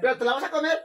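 high, loud teasing disbelief; mock disbelief. Real, not acted. Teasing her partner who will eat it, high and incredulous. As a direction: squeal it out in teasing shock.

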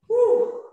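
high excited whoop. Real, not acted. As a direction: let out a cheer. Cheer during workout.

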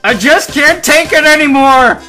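high, full-force yell; desperate cry. A narrator's reading. Truck man stumbles out of the wreck, crying out desperately. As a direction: scream it at the end of your rope. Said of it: "he cried".

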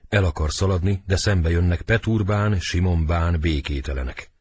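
normal talking voice

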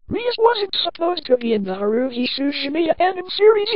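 high-pitched, exasperated protest; high-pitched outraged complaint; indignant disbelief. A narrator's reading. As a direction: object in a high, incredulous voice. Narrator protests wrong character appearing.

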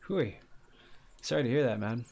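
normal talking voice

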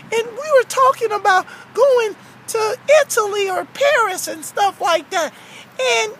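cartoon storytelling voice; aggrieved Frankie. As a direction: tell the grievance in character. Plays Frankie recounting the conversation.

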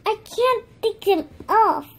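high, loud pretend complaint; playful frustration. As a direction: complain about being stuck in slime. Stuck in slime.

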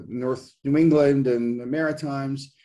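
ordinary delivery

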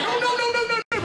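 high, quick protest; mock panicked girl. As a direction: plead with the lads to stop. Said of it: "she's going".

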